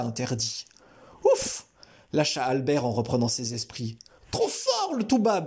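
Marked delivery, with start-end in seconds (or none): 1.2-1.7 high sigh of relief; relieved. A narrator's reading. Albert lets out relief after the man flees. 4.3-5.5 put-on high, admiring kid's cheer; cheering boy. A narrator's reading. Sofiane cheers Albert after the man runs off.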